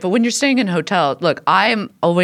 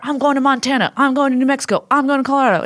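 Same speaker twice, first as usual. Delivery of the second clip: higher-pitched caricature of a panicky escapee; mock bug-out survivalist; 8 semitones higher than usual. Host mocks libertarians fleeing to nowhere, high-pitched frantic caricature. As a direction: imitate someone frantic to flee, pitched up. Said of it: "bug out people who are always like".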